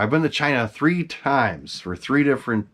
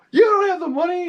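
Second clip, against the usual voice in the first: much higher, laughing disbelief on spent the money; comic high squeak of disbelief; retold incredulous laugh. Collector reacting to Frank spending his payment, laughing disbelief.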